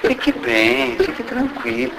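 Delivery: voz mansa e baixa, consolando; carinhoso suave. Retold or imitated. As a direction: acalme a parceira com ternura. (fala baixinho pra acalmar a namorada)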